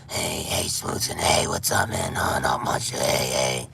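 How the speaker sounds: deep raspy ghoul whispers trading greetings; ghoul party chat. Retold or imitated. Acting out ghouls chatting online, rasping ghoul voices. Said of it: "could you imagine a whole party chat of ghouls".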